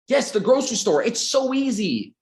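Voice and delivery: fired up about the easy answer; excited. Real, not acted. Excited grocery store example.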